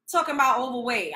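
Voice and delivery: ordinary talk, nothing marked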